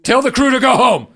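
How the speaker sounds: high, loud panic; rising alarm, near hysterical; host panicking about a bee in the studio; panicked. Acted. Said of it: "You're being incredibly dramatic".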